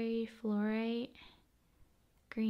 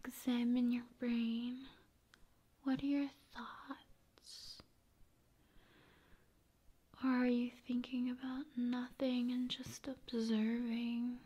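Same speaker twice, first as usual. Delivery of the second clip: ASMR whisper; 6 dB quieter than usual. Acted. ASMR host pretending to examine viewer's brain, whispering. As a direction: whisper like examining them closely.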